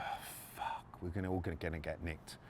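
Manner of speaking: hushed, breathless dread under the breath; panicked whisper. Retold or imitated. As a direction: whisper it like you're caught. Panic in the riot van.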